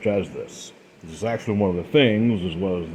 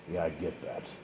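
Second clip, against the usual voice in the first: much softer, conceding "Yeah, I get that"; grudging murmur; 4 semitones lower, 15 dB quieter than usual. Acted. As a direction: let "Yeah, I get that" drop to a resigned low voice.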